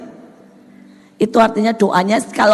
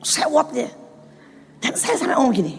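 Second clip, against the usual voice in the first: usual speaking voice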